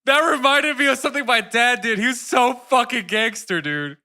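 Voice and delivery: high, loud burst of excitement; loud, thrilled outburst; real excitement. Real, not acted. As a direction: exclaim as a great story suddenly comes back. Suddenly remembers a story about dad.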